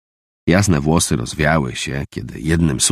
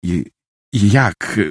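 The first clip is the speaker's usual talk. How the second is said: stammering; 4 semitones higher than usual. A narrator's reading. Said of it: "wyjąkał".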